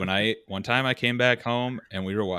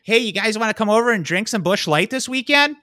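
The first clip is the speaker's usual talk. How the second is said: loud, high southern Minnesota accent; nasally southern Minnesotan invitation, pitched up; 12 semitones higher, 6 dB louder than usual. Shown as a demonstration. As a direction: put on an exaggerated southern Minnesota twang. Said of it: "a southern Minnesota accent's more like".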